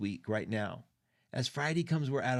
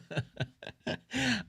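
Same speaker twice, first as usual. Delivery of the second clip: emotional laugh; 7 semitones higher than usual. Real, not acted. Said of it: "you're gonna make me cry".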